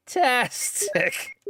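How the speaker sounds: slow, high sarcastic drawl. Real, not acted. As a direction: stretch it out with sarcasm. Wasted ammo, enemy healed.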